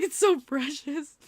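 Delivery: loud, drawn-out cooing; slow, charmed gush. Retold or imitated. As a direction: stretch it out, melting with delight. Gushing over the fan's innocent question.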